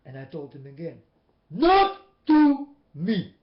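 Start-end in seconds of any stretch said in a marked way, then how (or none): 1.5-3.3 loud, higher and slow, word by word; firm rebuke. Retold or imitated. Telling the man firmly he can't talk to him like that. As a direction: spell it out hard and slow.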